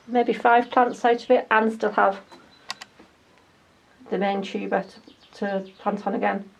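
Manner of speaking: normal talking voice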